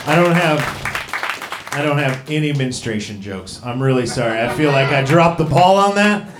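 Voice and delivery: ordinary talk, nothing marked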